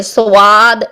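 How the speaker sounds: سواد with a clear w glide after the s; wrong added w. Shown as a demonstration. Teacher warning students of the mistake, سواد with an inserted w. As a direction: round the lips into s-waad on سواد. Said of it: "واو کی sound نہیں آنی چاہیے".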